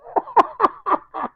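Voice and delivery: high chuckling laugh; high staccato giggle. Real, not acted. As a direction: laugh in quick high bursts. Laughs at his own joke.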